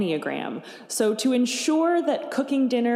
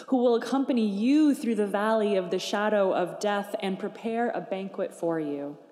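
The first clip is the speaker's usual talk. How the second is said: ordinary talk, nothing marked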